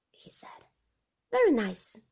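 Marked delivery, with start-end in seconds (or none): 1.3-2.0 a touch of polite reserve; cool, polite two words; politely. Acted. Bride answering Favell about his car, politely. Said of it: "I said politely".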